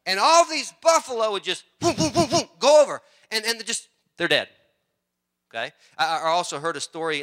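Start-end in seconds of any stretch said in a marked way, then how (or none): 1.8-2.5 loud, high, repeated whoops; imitated tumbling noises. Preacher miming buffalo going over the cliff, loud tumbling whoops. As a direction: bodies dropping one after another in quick whoops.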